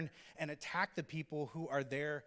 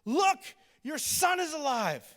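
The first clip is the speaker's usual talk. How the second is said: much higher, louder joyful cry; elated announcement; 12 semitones higher, 9 dB louder than usual. Retold or imitated. Elijah presenting the revived boy to his mom, elated. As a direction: burst out with the good news. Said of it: "He says this in verse twenty-three".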